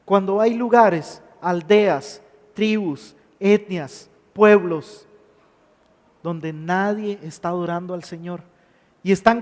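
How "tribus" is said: normal talking voice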